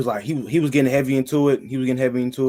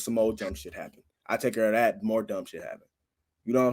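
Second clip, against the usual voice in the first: usual speaking voice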